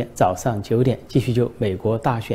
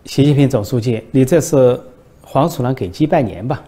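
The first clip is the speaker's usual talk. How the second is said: a loud, sour jab at his caller; grumpy retort; 2 semitones higher, 6 dB louder than usual. Acted. Macron answers Xi's condolence call, annoyed and loud. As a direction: snap it back, half-acted grumpiness. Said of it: "没好气地说".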